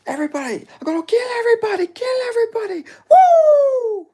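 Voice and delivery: very high screaming impersonation ending in a loud woo; Hamza. Acted. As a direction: screech it in a wild falsetto. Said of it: "That's my Hamza".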